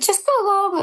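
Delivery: high, far squeaky quoted voice; lightly mocking the babysitting dad; mimicking the friend. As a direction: toss it off in a high put-on voice. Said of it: "he said".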